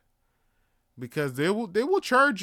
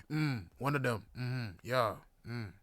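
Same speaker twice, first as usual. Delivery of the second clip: muttered order; 5 semitones lower, 6 dB quieter than usual. Retold or imitated. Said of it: "That's how I talk".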